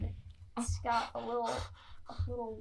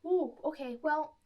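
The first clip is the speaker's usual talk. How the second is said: high nervous giant; 4 semitones higher than usual. Acted. Giant answers the party.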